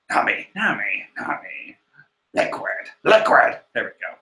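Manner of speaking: low gravel-edged Snake impression, half whispered; Solid Snake voice. Luffy asking Nami to fly, done as Solid Snake. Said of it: "Luffy is voiced by Solid Snake".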